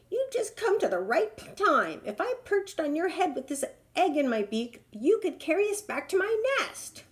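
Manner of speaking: high, eager bird voice; pleading hornbill. Acted. Hornbill begging the giraffe to carry her, high. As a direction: coax him, high and hopeful.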